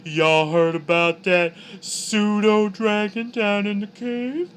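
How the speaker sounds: slow, high catty gossip; gossipy monster voice. Acted. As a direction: dish the dirt like a drag queen monster. Flump gossips about pseudo dragon.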